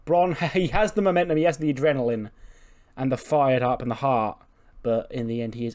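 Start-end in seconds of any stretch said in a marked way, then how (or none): none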